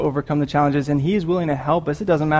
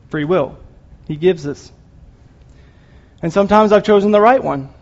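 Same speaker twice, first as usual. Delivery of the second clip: usual speaking voice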